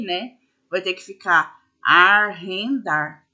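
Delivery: arrendar said slowly in separate syllables; split into syllables. Shown as a demonstration. Teacher modelling the syllable split for students, slowly. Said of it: "a separação silábica aqui".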